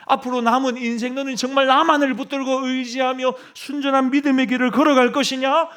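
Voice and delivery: a persistent, probing divine question rising in force; insistent voice of God. Acted. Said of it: "하나님이 집요하게 물으시는 거예요". (집요하게 묻는 하나님의 목소리)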